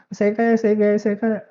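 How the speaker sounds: rote affirmation. Acted. Coach demonstrating self-talk that rings untrue, rote chanting. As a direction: recite it like a repeated self-affirmation.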